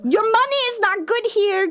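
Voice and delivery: high, slow, indignant yell; mock outrage. Yelling at chat riding train.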